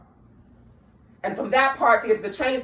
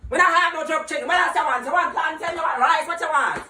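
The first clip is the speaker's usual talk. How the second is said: Jamaican cook; 6 semitones higher than usual. Retold or imitated. Mocking a rude Jamaican cook demanding the order, Jamaican accent. Imitates Jamaican spot worker.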